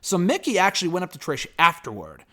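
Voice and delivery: normal talking voice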